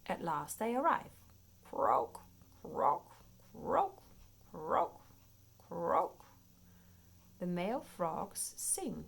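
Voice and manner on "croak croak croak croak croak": croaky frog calls, pitch jumping; frog croaks. Pretend. Reading a frog book aloud, croaking like frogs.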